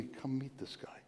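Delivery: soft whisper, come meet this guy; whispering an invitation to a friend. Retold or imitated. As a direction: breathe it like a secret invite. Quoting a gentle invitation.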